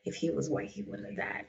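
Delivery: quietly muttered, almost whispered; under breath. Real, not acted. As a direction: say it half to yourself. Repeats line under breath.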